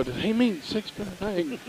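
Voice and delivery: hushed, slow murmur to a teammate; whispered aside. Retold or imitated. Player whispering to teammate Tim during practice, hushed and slow. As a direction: sneak the question quietly to the guy beside you. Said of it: "And I whispered to Tim".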